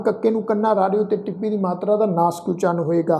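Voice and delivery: ordinary talk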